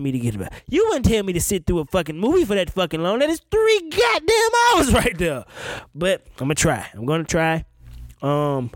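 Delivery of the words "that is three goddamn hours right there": high, loud and slow, breaking into a laugh; exasperated laughing yell. Real, not acted. Griping about three hours of boring episodes, laughing yell. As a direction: blow up at the idea, laughing.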